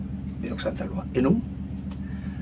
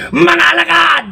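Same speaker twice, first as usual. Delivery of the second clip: high loud devil shout; shouting Satan; Satan's call; 10 semitones higher, 3 dB louder, slower than usual. Acted. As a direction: summon your minions. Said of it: "Sabay hiyaw, Satanas".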